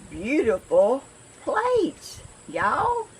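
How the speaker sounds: excited. Real, not acted. Excited about dollar store plates.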